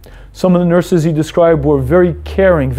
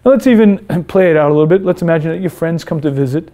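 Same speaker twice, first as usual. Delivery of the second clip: ordinary delivery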